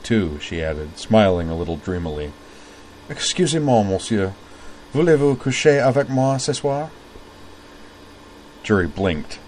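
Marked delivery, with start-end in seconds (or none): 3.1-6.9 sultry French, raised higher; French accent. Acted. Jury greeting a stranger in French, French accent. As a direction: purr it in French like a flirt. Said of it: "Ah, you speak French".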